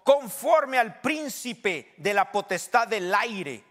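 forceful preacher reading aloud; emphatic preaching. Real, not acted. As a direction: proclaim the verse phrase by phrase.

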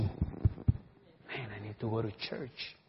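hushed inner voice; inner thought voice. Pretend. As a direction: murmur it to yourself as a private realization. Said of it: "es como una impresión".

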